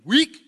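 "weak" blurted high and very loud; capital-W emphasis. Retold or imitated. Preacher voicing Gideon's self-doubt, "weak" shouted high. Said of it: "I'm capital W".

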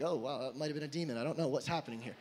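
whisper-ish fast aside to yourself; quick hushed worried inner voice; startled aside. Retold or imitated. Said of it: "And I was like".

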